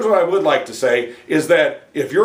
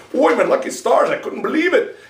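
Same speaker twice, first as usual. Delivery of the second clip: higher, excited Irish brogue; Irish exclamation; 7 semitones higher than usual. Pretend. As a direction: exclaim it in a hammed-up Irish accent. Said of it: "a wee bit of the Irish".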